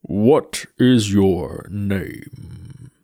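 slow, grave skeleton voice; Death's voice. Acted. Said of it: "said the skull".

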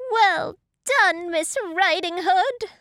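high, loud pig cheer; yelling. A narrator's reading. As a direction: yell congratulations from the window. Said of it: "stood and yelled".

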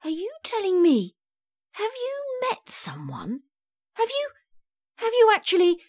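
very high, halting disbelief; shocked. A narrator's reading. Daughter learns Mum met someone, shocked. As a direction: squeak out incredulous questions, breaking off. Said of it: "I was shocked".

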